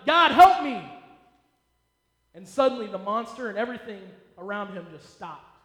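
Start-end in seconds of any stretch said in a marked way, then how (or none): 0.1-0.8 loud panicked cry for help; panicked shout. Retold or imitated. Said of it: "He panicked and shouted".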